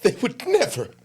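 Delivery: high put-on mock outrage; sarcastic. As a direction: sing it up high, dripping sarcasm. Sarcastic reply to racism complaint.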